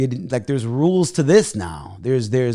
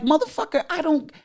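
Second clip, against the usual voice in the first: high-pitched retold tirade, easing down toward the end; worked-up swearing rant, starting very high and settling; going off; 9 semitones higher than usual. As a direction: replay yourself telling them off. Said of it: "you know, I went off on".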